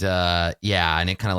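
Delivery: normal talking voice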